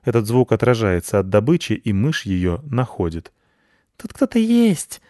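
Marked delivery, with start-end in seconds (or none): 4.0-5.0 very high, squeaky bat voices; high squeaky alarm; squeaking bats. A narrator's reading. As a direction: squeak it out like tiny frightened bats. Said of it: "запищали мыши".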